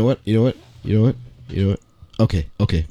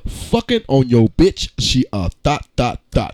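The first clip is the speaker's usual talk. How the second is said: dramatic reading; 6 semitones lower than usual. Shown as a demonstration. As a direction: read rap lyrics like a poem on stage. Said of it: "we are gonna have a dramatic reading".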